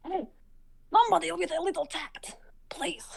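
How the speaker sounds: urgent stage whisper from a hidden voice. Acted. Game master as tower voice beckoning Finley, whispering. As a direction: whisper it to one listener only. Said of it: "it's a whisper and only you can hear it".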